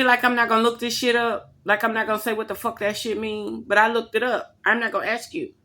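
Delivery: ordinary delivery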